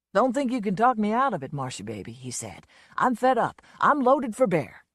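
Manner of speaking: a lightly voiced boozy Southern showman; a hint of a drunk country star, fed up; lightly played drunk blowhard; Lonesome's voice. A narrator's reading.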